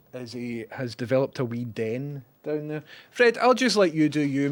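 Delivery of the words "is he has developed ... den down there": normal talking voice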